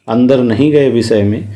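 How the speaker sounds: normal talking voice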